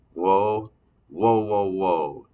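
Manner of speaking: loud stunned whoas, fading out; a kid caught off guard. Acted. Narrator stunned by mom's question.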